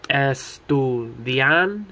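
estudiante broken into ES, TU, DIAN, TE; split into syllables. Shown as a demonstration. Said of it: "Four syllables".

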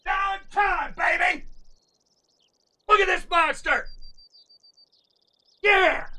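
high shouts, not at full volume; jubilant yelling. Real, not acted. Hunter hails his monster trophy, jubilant yell. Celebrates the diamond.

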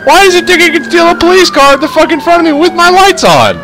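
very high, incredulous rant; outraged yell. Real, not acted. Gamer watching his police car get stolen, outraged yell. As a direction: yell it high, in sheer disbelief.

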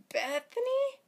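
high, loud questioning call; disbelieving, high-pitched question; stunned croak. A narrator's reading. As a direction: say the name as a startled question. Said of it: "Owen croaked, his voice almost too quiet".

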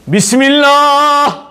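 Bismillah sung out high, slow and loud like opera; loud drawn-out bismillah. Shown as a demonstration. Preacher mocking over-loud prayer, loud operatic Bismillah. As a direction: belt Bismillah out like an opera singer. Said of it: "böyle yüksek sesle".

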